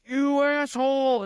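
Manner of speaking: hammed-up yell of abuse; mock angry yell. Coder acting out swearing at the AI, hammed-up yell. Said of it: "I'm just like".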